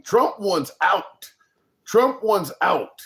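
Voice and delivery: Trump wants out, slow and firm; steady cadence. Real, not acted. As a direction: lean slowly on Trump. Repeated slow emphasis.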